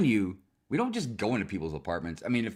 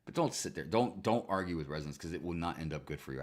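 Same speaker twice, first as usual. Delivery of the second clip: usual speaking voice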